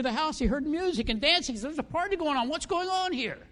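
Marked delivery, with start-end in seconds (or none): 1.7-3.4 surprised. Retold or imitated. Elder brother hears music.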